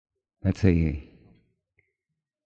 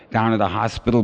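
normal talking voice

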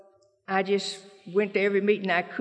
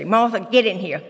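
stern summons; 7 dB louder than usual. Retold or imitated. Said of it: "every time I'd go by her office, she'd say".

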